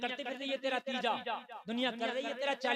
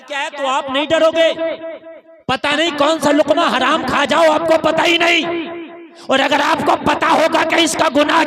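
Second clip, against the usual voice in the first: loud preacher's exhortation; loud sermon cry; fiery preaching; 5 semitones higher, 21 dB louder than usual. Acted. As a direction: thunder it to the crowd. Preacher at full force.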